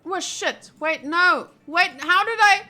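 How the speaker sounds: ordinary talk, nothing marked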